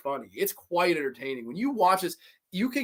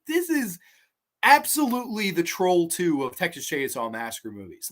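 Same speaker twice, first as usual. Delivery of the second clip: normal talking voice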